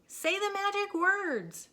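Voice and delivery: high loud slow shout; urgent call. A narrator's reading. Moo shouting to Minnie under attack. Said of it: "shouted Moo".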